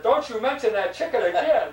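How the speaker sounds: loud, high punchline outburst; angry warning. Retold or imitated. Priest cutting off the boy's confession, angry warning. Priest explodes at mention of chicken.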